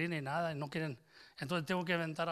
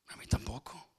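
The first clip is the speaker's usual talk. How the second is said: whispered aside; 2 semitones lower than usual. Real, not acted. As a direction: whisper it as a private admission. Confessional aside to congregation.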